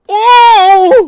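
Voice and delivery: high, loud startled cry; startled exclamation. Real, not acted. Streamer startled by a chat message, high loud cry. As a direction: jump at what was said.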